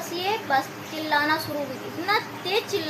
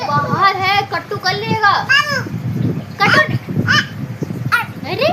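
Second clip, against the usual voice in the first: mock scary warning; 7 semitones higher, 8 dB louder than usual. Real, not acted. Mother warning her toddler the dog outside will bite, mock scare.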